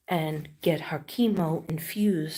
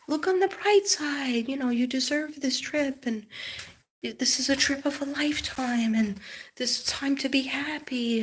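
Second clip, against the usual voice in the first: husband's voice; 8 semitones higher than usual. Retold or imitated. Quoting husband's upbeat advice.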